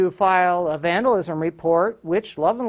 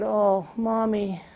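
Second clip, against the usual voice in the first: slow, higher, drawn-out mock sympathy; imitated three-year-old; 5 semitones higher, slower than usual. Three-year-old consoles frustrated mom at computer, imitated childlike sympathy. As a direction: pity Mommy as a little child would, slowly. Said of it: "our three-year-old walked over and he said".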